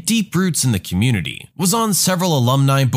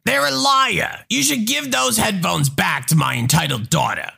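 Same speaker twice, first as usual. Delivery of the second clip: higher entitled mother; 7 semitones higher than usual. Acted. Mother demanding the employee stop the narrator, higher. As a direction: play the indignant customer.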